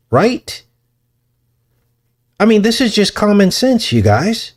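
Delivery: high-pitched mock-presidential appeal; Obama impersonation. Podcaster as the president appealing to common sense, high-pitched Obama impersonation. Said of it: "My Obama impersonation".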